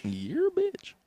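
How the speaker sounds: high squeaky echo; high mock echo. Echoing back.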